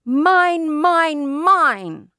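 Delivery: high, loud chant of mine; possessive toddler. A narrator's reading. Toddler hugs the book and won't give it back, loud and high. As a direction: clutch it and refuse like a toddler.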